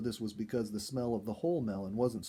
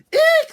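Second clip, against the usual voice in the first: shrill high shriek of fright; a sudden startled eek, very high and loud; surprised scream; 20 dB louder than usual. A narrator's reading. Dorothy startled by the screen noise, high loud shriek. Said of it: "screamed Dorothy".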